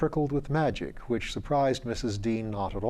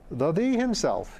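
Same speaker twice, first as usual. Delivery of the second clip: cheerily; 6 semitones higher than usual. Acted. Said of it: "he returned cheerily".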